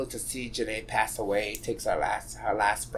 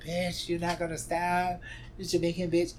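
higher, playful imitation of her friend teasing "this Jamaican bitch"; friend's teasing voice; 7 semitones higher than usual. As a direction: tease like your best friend calling you out. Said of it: "and she would be like".

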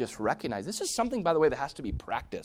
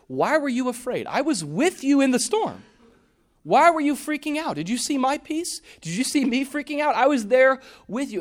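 high, loud pleading reproach; Jesus urging his disciples, loud and high; impassioned question; 12 semitones higher, 8 dB louder than usual. Retold or imitated. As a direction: press them: why were you afraid. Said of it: "That's the most important part".

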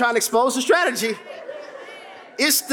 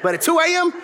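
ordinary delivery

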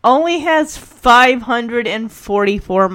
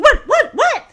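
high, rapid-fire alarmed questions; imitated startled questioning; 13 semitones higher, 5 dB louder than usual. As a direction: mimic a daughter jolted into panic. Acting daughter's startled reply.

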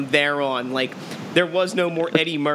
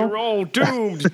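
slow ominous drawl; 5 semitones higher, much slower than usual. Pretend. Riffing on soothsayer warning.

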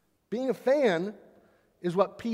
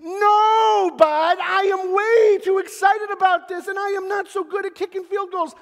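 high, loud, frantic refusal, voice strained; panicked shout; 12 semitones higher, 8 dB louder than usual. Pretend. Fan in the stands refuses to kick, shouting in panic. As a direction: yelp it out like a fan begged to kick. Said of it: "You'd go".

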